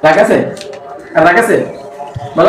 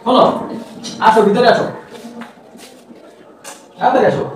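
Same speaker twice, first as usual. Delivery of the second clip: usual speaking voice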